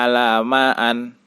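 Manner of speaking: "alamaan" closing on -an; wrong -an ending. Shown as a demonstration. Teacher showing the misreading, "alamaan" ending in -an. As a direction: end "alamaan" with a and n instead of -in. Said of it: "atau tidak dibaca".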